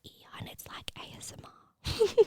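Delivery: soft joking whisper; whispered ASMR aside. Real, not acted. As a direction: keep it hushed, close to the mic. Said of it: "whispering in your ear".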